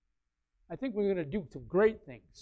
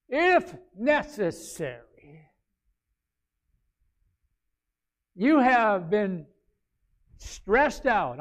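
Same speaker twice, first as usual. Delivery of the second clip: loud, slow, raised reading; scripture read; 5 semitones higher, 11 dB louder, much slower than usual. Real, not acted. Pastor reads scripture aloud to the church, loud and slow. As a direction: declaim the verse.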